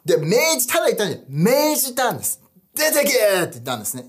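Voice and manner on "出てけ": higher, forceful order, not gentle at all; stern command. Retold or imitated. Jesus ordering the demon out, higher and forceful. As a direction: cast it out with authority. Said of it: "もう優しい感じじゃない".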